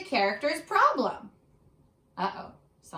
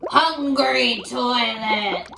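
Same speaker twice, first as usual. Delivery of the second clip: very slow, louder goofy monster voice; 9 dB louder, much slower than usual. Acted. Max the little monster gleefully feeding the toilet. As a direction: stretch it out like a silly little monster. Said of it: "said Max".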